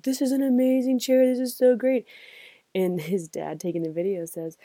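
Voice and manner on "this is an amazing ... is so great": higher, dazed voice, amazed by the chair; stoned patient's delight. Retold or imitated. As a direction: play a drugged guy in awe of a chair. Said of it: "He's like".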